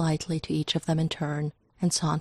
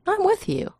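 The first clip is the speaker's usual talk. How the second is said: lightly voiced Grant. A narrator's reading.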